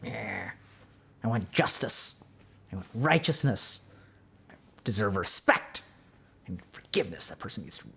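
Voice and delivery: low, soft voice of the grudging mind; low, resentful inner voice demanding justice. Acted. The mind muttering its grievance low and soft. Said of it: "And it's like".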